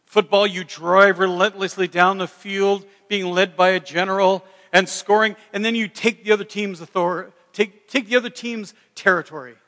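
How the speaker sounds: loud, emphatic battle briefing; forceful military bombast. Acted. Comedian's routine on football as war, forceful bombast. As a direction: drive each phrase like a general.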